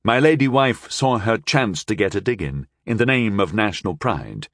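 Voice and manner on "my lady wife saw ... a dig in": ordinary delivery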